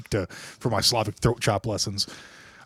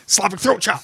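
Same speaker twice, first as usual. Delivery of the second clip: higher, hammed-up fighting call; mock martial artist; 9 semitones higher than usual. Hammed-up fighter cry, threatening his friend playfully. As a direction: announce the move like a cartoon fighter. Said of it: "I'll throat chop you".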